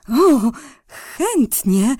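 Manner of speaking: slow, high, eager reply; light up at the offer; delighted. Acted. Boguś delighted by fish. (radosne ożywienie)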